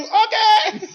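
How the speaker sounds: very high laughing okay; laughing mom impression. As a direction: squeak the okay through laughter. Mom's reply, laughing.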